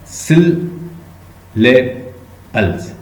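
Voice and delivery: Syllables broken into slow, separate beats; syllable by syllable. Shown as a demonstration. Teacher splitting a word for students to count, syllable by syllable. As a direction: say syllables very slowly, a pause after each syllable. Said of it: "कितने syllables?".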